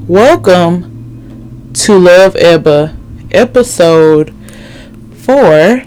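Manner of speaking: slow, loud host welcome; podcast host intro. Acted. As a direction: announce it like a show host. Show welcome.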